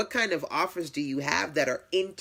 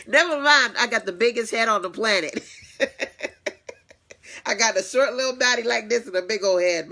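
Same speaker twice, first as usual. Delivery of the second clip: louder, higher, fast laughing self-joke; laughing self-mockery; 7 semitones higher, 6 dB louder, faster than usual. Real, not acted. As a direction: tease yourself, giggling. Joking about her big head.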